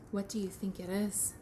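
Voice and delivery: usual speaking voice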